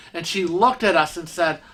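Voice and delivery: ordinary talk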